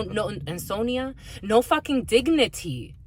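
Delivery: ordinary talk, nothing marked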